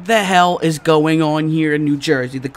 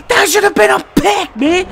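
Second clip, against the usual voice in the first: high, loud protest; real high-pitched complaint; frustrated shout; 14 semitones higher, 5 dB louder than usual. Real, not acted. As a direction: yell in outraged disbelief. Protests the missed interception.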